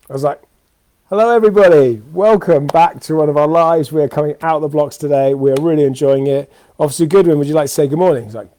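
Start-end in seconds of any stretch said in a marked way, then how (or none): 1.1-8.2 much higher and louder, a beaming host welcome; live presenter voice. Retold or imitated. Opening the live stream to the public, a big upbeat host voice. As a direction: switch on the bright show-host voice, slowing on the welcome.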